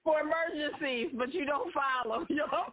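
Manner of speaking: high-pitched talk through real laughter; laughing hard. Real, not acted. Co-host amused by the caller's divorce joke, laughing hard. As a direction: crack up while agreeing. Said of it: "that's why I'm laughing so hard".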